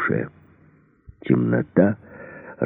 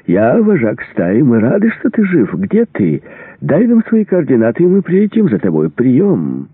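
very high, gushing fake cheer; false joy; 9 semitones higher, 3 dB louder than usual. A narrator's reading. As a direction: lay on the phony delight, stretched and sing-song. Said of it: "В голосе Мердока звучала фальшивая радость". (с наигранным восторгом, высоким голосом)

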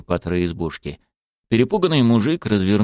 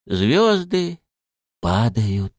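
put-on old man mumbling; 3 semitones higher, much slower than usual. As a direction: drone it out like a dreamy old man. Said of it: "пробубнил старик". (старик тихонько бубнит)

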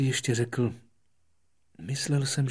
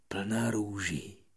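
usual speaking voice

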